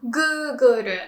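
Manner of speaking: Google as guu-gu-ru, stretched first vowel; careful Japanese Google, guuguru; Japanese pronunciation. Shown as a demonstration. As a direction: demonstrate Google with a tapped final ru. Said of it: "we say".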